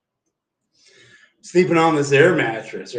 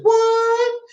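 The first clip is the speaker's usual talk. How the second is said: shocked squeal; 4 dB louder than usual. Retold or imitated. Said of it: "And I was like".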